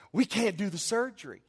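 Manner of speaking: a hint of cheery nurses breaking news; smiling nurses. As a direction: mimic nurses talking through big smiles. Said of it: "they're smiling from ear to ear".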